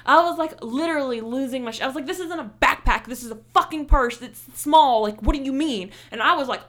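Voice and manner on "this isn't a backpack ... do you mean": loud, high, outraged; shouting her down over a small purse; furious arguing. Retold or imitated. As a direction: let the anger boil over at the guard. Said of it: "arguing with this lady".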